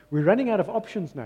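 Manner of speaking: ordinary talk, nothing marked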